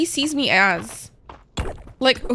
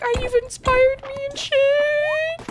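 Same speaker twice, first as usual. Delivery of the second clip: imitating Graha; slower than usual. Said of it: "Graha being like".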